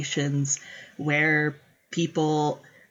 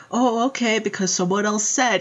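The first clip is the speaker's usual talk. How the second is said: higher, limp easily-led voice; mock pushover; 6 semitones higher than usual. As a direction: mimic a spineless yes-man. Mom mocking Bingley.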